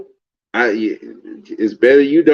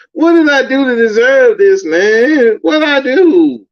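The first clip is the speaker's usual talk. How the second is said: much higher, louder cry to the heavens; hammed-up anguished plea; wailing what did I do, man; mock wail; 13 semitones higher, 4 dB louder than usual.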